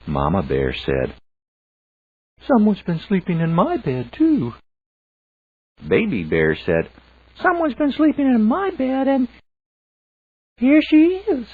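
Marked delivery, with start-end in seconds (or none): 2.4-4.5 much higher, quicker mother bear; Mama Bear voice. A narrator's reading. Mama Bear finding her chair used, high and quick. 7.4-11.5 very high, loud little cub voice; Baby Bear voice. A narrator's reading. Baby Bear wailing that his cobbler is gone, very high and loud.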